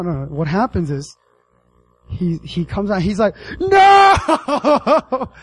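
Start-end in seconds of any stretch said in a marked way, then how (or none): 3.6-5.3 hammed-up anguished "no", high and loud; long melodramatic howl of despair, played for laughs; dramatic Shakespearean cry. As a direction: wail it like an overacting tragedian. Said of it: "He goes all dramatic Shakespeare on him".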